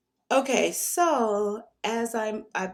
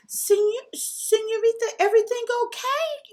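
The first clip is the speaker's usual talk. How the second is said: imitating a Mexican man's broken English, much higher voice; broken English Mexican accent; 4 dB louder than usual. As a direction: put on the Mexican guy's accent, high and eager. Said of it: "he's talking to me in his broken English".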